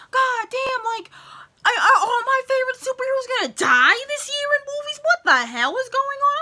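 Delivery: very high, loud, anguished disbelief; exasperated outburst. Retold or imitated. Fan reliving superhero deaths, very high exasperated outburst. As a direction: wail it like every hero is dying on you. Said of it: "I'm thinking".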